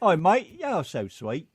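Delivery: loud start, mock British-Southern accent; hammed-up British Tennessee mash-up. Shown as a demonstration. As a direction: call out in jokey British Tennessee. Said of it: "Do a British Tennessee accent".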